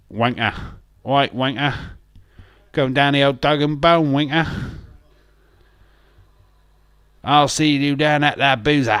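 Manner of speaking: brash Cockney geezer; London accent. Shown as a demonstration.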